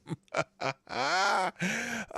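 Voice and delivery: high-pitched real laugh. Real, not acted. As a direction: burst of helpless high laughter. Cracking up at embassy's reply.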